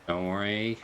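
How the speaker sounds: ordinary talk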